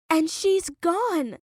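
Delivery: high, slow, bitter snap; snapped. A narrator's reading. Olivia cutting off the gallery owner, high bitter snap. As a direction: cut her off sharply. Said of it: "Olivia snapped".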